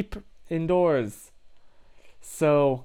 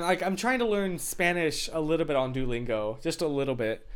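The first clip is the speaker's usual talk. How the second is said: normal talking voice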